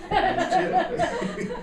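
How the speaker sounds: laughing falsetto squeak; squeaky laughing. Real, not acted. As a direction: squeeze it out high through giggles. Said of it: "I can tell when God's talking to me".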